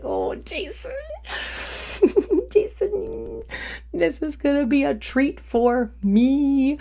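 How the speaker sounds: high, delighted laughing over the name; gleeful laugh. Real, not acted. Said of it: "And I was like".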